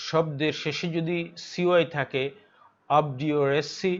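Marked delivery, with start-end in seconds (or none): none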